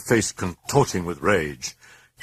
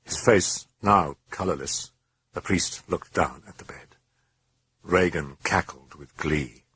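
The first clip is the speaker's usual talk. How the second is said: ordinary delivery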